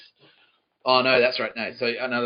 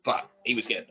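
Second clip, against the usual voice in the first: ordinary talk, nothing marked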